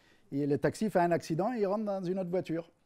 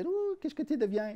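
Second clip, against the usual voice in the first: voiced character; 12 semitones higher than usual. Joke dialogue, voiced character.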